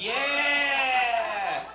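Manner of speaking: long, high, drawn-out cheer; hammed-up ecstatic yell; gleeful mock shout. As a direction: whoop it and hold the vowel. Acted glee over heroin spoon.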